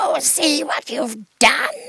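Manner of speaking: high, slow, shrieking cry of fear; a melting witch shrieking in terror; witch screaming. A narrator's reading. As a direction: scream as the witch starts to melt. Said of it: "she screamed".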